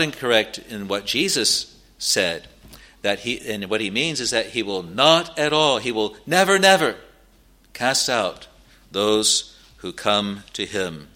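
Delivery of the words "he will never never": "never, never" punched, the second higher and louder; climbing stress on "never, never"; emphatic repetition. Real, not acted. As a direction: step up hard on the second "never". Preacher stressing the double negative.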